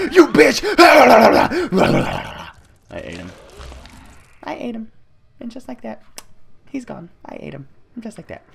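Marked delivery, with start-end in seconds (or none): 0.1-0.6 loud, high outraged yell; furious shout. Real, not acted. Shouting at enemy. 0.7-2.5 loud, high shrieking cackle; maniacal cackle. Real, not acted. Maniacal laugh after kill. 4.4-8.4 creepy sing-song. Acted. Creepy gleeful after eating.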